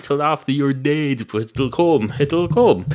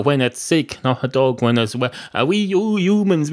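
ordinary delivery

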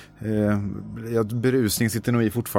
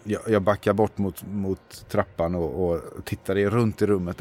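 ordinary delivery

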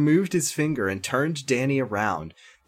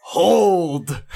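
raised, louder voice of authority; loud command; 5 semitones higher, 6 dB louder than usual. A narrator's reading. Chris stops Danny leaving, loud command. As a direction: call it out to stop someone. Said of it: "said Chris in a loud voice".

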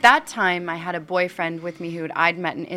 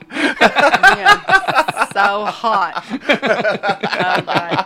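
laughing; 3 semitones higher, 8 dB louder, much slower than usual. Real, not acted. Laughing over Tom Brady.

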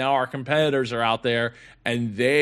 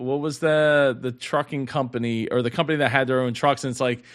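ordinary delivery

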